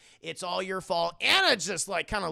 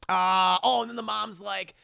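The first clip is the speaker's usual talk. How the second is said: normal talking voice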